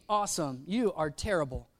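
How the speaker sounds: ordinary talk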